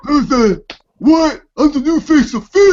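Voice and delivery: high, loud creepy villain voice; hammed-up high menacing wrestler; Bray Wyatt voice. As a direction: shriek it like a creepy cult villain. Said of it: "he's like".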